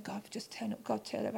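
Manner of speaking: a quick hushed prayer to herself; muttered prayer. Retold or imitated. Praying desperately mid-meeting.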